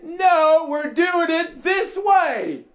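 high, loud overruling shout; boss's firm order. Acted. Preacher as boss correcting workers on job site, loud and firm. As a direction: shout it down, stretching "this way". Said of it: "He showed up on the job site and said".

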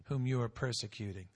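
ordinary talk

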